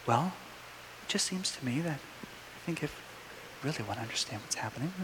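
hushed, low-pitched imitation of a calm teacher; very low, quiet voice. Teacher defusing a heated class, talking very low and quietly. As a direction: drop right down so everyone leans in. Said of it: "he starts talking like this".

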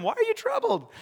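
lightly amused, high-pitched question; smiling question. Acted. Jesus asking his frightened disciples, smiling. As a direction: ask it high, with a smile. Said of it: "I think he was smiling".

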